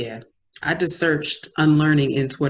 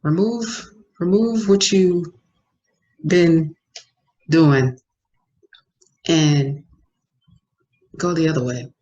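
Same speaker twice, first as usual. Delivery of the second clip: each word slow and louder; slow emphatic advice; 5 dB louder, slower than usual. Acted. Advising listeners to change course, slow and emphatic. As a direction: hammer every word home.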